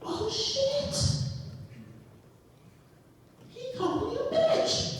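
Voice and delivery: very high-pitched squeal of disbelief; mock shock. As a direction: squeal it as if scandalized. Mock shock at being insulted.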